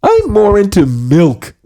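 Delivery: put-on cheery kids' show bear voice; friendly bear host. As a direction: welcome the kids into the big blue house. Bear sketch continues.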